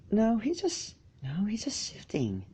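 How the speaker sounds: breathy hushed whisper, barely voiced; soft unvoiced whisper between friends. A narrator's reading. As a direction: whisper so the sleeper won't wake. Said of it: "whispered some pony else".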